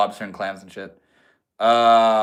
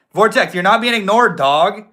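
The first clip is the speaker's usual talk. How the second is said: loud, higher call to a chatter; 9 semitones higher, 7 dB louder than usual. Real, not acted. Streamer calling out to an ignored chatter, loud. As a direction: raise the voice to reach him.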